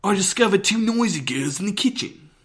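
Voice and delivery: loud, high, over-the-top British accent. Shown as a demonstration. Actor showing off a line from the ghost play, loud British accent. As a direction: belt it out in a theatrical British accent. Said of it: "a British accent for a play".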